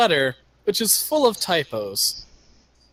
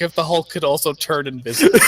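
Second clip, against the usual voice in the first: normal talking voice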